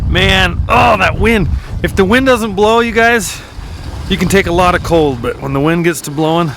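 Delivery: loud and high, calling over the gusts. Real, not acted. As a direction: push your voice over the howling wind.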